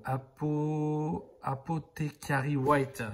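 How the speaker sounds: lightly hammed English accent on the paint name; mock English accent. Shown as a demonstration. Painter naming his paint in a jokey English accent. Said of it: "qui a l'accent anglais incroyable".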